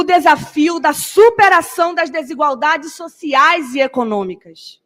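loud, fierce protest oratory; fiery speech. Real, not acted. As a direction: build up the crowd, forceful. Student leader's fiery speech.

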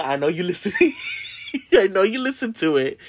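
laughing through the words. Real, not acted. Speaker teases friend Vanessa who knows, laughing. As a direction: giggle while teasing your friend.

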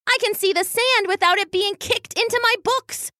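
high, insistent little character voice; stubborn anxious weasel. A narrator's reading. Weasel defending staying home to her brother, stubbornly insistent. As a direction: argue high-pitched, hammering the repeats.

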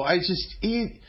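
ordinary talk

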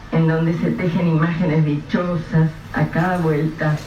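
ordinary talk, nothing marked

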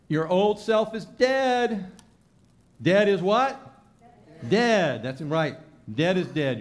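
high, loud, emphatic preacher; preaching at full force. Acted. Preacher urging the congregation, full-force preaching. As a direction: drive each line home from the pulpit.

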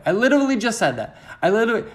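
high, loud outburst; mock exasperation. Vlogger catching himself repeating, loud mock exasperation. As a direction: leap up high at your own silliness.

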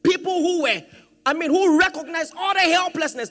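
high, ringing scripture proclamation; forceful preaching. Real, not acted. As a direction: proclaim it with full conviction.

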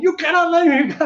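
high, loud mock scream; imitating screaming. Controller screaming at the landing jet, high mock scream. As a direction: scream the refusal in a panic. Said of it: "the guy starts screaming".

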